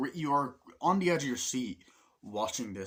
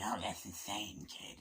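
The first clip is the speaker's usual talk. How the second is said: mocking whisper; 7 dB quieter, slower than usual. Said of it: "kid's like".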